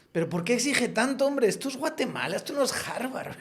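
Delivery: high, laughing imitation of a whiny student; mocking student voice. A student asks his acting teacher why so strict, high mocking whine. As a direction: play the student who complains, half laughing. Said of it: "me dijo un alumno".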